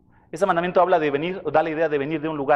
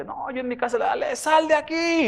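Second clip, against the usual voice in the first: retold shouting; 8 semitones higher than usual. Preacher mocks a man shouting at Satan, mock yelling. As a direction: imitate a man rebuking the devil. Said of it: "y gritaba".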